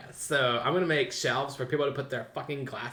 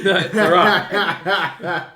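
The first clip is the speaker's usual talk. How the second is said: laughing it out slowly, loud; cracking up while insisting; 5 semitones higher, 9 dB louder, much slower than usual. Real, not acted. Laughing at a friend's story, slow and loud.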